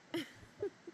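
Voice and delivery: short breathy amused chuckle; a light scoffing laugh under the breath. Acted.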